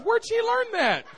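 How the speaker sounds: high-pitched, scandalized demand; aghast question. Retold or imitated. Husband to wife about the toddler's dancing, high aghast question. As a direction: ask it in comic parental shock.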